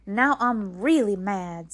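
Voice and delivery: loud, slow, emphatic anger; angry outburst. A narrator's reading. May May finds her hat under Rose's bed, angry. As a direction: bear down heavily on each word, furious.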